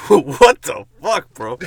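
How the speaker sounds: loud, slow what the fuck bro; mock yell. Dreamer wakes up and yells in shock, slow mock yell. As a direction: drag it out in a shocked shout. Waking up shouting in story.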